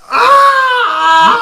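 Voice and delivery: sharp cry of pain; pained yelp. Acted. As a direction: yelp as the punch lands. Punched by the leprechaun.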